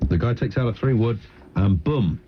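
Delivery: ordinary talk, nothing marked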